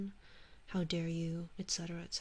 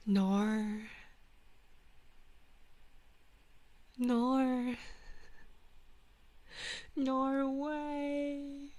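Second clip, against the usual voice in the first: drawn-out Australian-style vowel; Australian accent; 5 semitones higher, much slower than usual. Pretend. Streamer testing if it's Australian, mock Australian accent. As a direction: try stretching an Australian "no". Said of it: "Isn't that Australian?".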